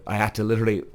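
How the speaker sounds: ordinary talk, nothing marked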